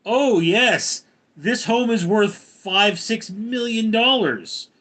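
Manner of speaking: loud, high, drawn-out boasting; mock rich homeowners. Streamer mimicking owners of million-dollar homes, mock boasting. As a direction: play a hammed-up, self-satisfied millionaire, slow and high. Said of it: "they were like".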